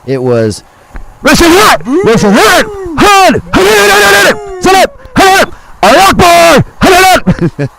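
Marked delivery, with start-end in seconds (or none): 1.2-7.7 very high, loud hoarse shouting; stadium yelling. Shown as a demonstration. Showing what he screamed at the game, full stadium yell. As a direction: yell it like you're in the stands. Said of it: "That's what I was yelling".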